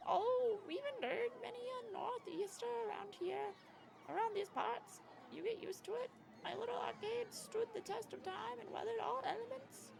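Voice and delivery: put-on high, quavery old lady. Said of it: "I tried to do an old lady voice".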